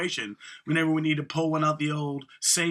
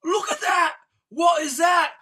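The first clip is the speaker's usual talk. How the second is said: high, loud put-on voice yelling; mock character shout; 8 dB louder than usual. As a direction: squeal it out like a baffled clown. Retelling Sacha Baron Cohen bit.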